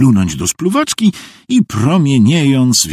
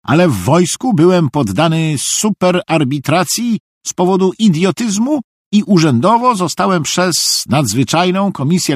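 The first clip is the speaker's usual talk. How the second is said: high, earnest Szwejk voice; solemn high-pitched fool; very grave simpleton; 10 semitones higher, 3 dB louder than usual. A narrator's reading. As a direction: explain with great seriousness in a high voice. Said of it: "odpowiedział Szwajk z wielką powagą".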